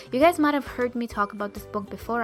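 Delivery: ordinary talk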